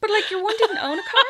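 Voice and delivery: high, laughing through words; real laughter at the story. Real, not acted. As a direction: giggle as you tell it. Laughing at the punchline.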